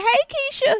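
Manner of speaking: perky high greeting back; high mock roommate voice. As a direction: Monica greeting a classmate. Roommate voiced in retold story.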